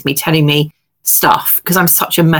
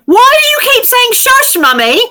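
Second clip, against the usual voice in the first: put-on high, loud toddler voice asking why; loud child voice; 4 dB louder than usual. Mother imitating her son asking why she keeps saying shush. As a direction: pipe up with a small child's puzzled question.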